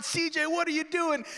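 high pleading shout; frantic yell. Retold or imitated. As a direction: shout after the running dog. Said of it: "And I'm yelling".

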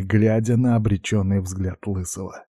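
usual speaking voice

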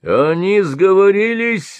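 high, loud slow accusing cry; shout. Acted. Merchant in bast shoes shouting an accusation. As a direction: cry out the plot. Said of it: "закричал Рахданит в лаптях".

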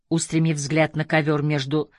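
ordinary delivery

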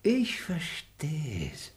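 sly whispered understanding; knowing whisper. Acted. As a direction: murmur it knowingly. Repeated knowing mutter.

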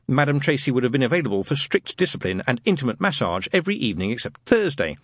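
usual speaking voice

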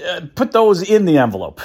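laughing through the words; amused laughing complaint. Real, not acted. Laughing at old cheap stamps.

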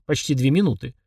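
usual speaking voice

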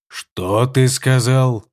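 cold, very slow emperor's question; icy voice. A narrator's reading. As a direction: freeze him with each word. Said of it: "ледяным голосом переспросил небесный император".

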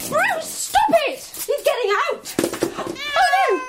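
high, alarmed cry; panicked yell. Acted. Shouting at Bruce as he's getting out, panicked. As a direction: yell at a pet that's getting loose. Cat is escaping from basket.

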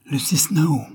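high, excited cry of a name; a bit hysterical. Acted. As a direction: call her name in a rush of overexcitement. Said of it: "d'une voix un peu hystérique". (cri un peu hystérique)

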